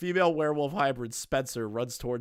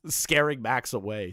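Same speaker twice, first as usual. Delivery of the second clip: normal talking voice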